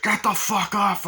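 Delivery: high, loud shout; an imitated furious yell; retold yell. Fight in the toilet, retold.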